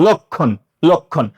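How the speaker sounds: লক্ষণ as lok-khon, low and measured; pronunciation demo. Shown as a demonstration. Teacher models the conjunct rule, pronunciation demo. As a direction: say লক্ষণ with the middle vowel as o. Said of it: "যুক্ত বর্ণের অ উচ্চারণে সবসময় ও হবে।".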